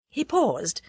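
ordinary delivery, nothing marked

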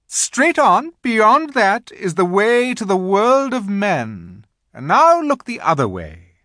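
high, loud, grand directions; commanding Queen. A narrator's reading. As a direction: point the way like an imperious queen.

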